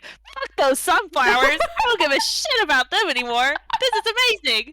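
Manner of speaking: hammed-up Linus, thrilled beyond words; mock overexcited Linus. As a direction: ham up his thrill, loud and high. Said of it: "he's like".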